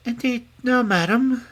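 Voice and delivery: high, woeful princess voice; sad princess. A narrator's reading. Princess declining supper from the old woman, sadly. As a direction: answer mournfully as a poor princess.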